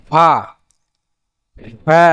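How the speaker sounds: ordinary talk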